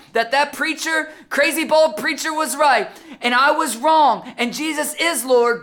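high, loud urgent warning; full-force preaching. Real, not acted. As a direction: preach judgment with mounting force.

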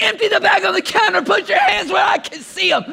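high, loud hammed-up cop shout; cashier's mock threat. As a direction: bark orders like a cop at gunpoint. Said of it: "She's like".

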